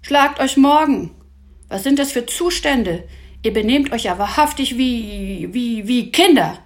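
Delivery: loud, high-pitched telling-off; indignant acted reprimand; scolding. A narrator's reading. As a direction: rebuke them with exasperated force. Professor scolds the squabbling boys.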